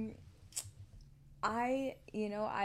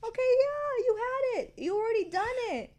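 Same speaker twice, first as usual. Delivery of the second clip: much higher, sing-song comforting voice; soothing parent; 11 semitones higher, 6 dB louder than usual. Pretend. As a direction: coax a fallen child back up. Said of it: "It's like".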